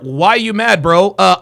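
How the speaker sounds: ordinary talk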